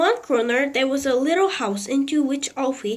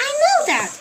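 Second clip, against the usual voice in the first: put-on fretful old lady; 4 dB louder, much faster than usual. A narrator's reading. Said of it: "Missus Silver said".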